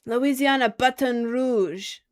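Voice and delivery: slow, drawn-out Louisiana drawl on Baton Rouge; Louisiana accent. Shown as a demonstration. Streamer to chat, saying the city name in a Louisiana accent. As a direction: say it like a Louisiana local, stretched out. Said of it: "I have to say it in an accent or else I can't say it".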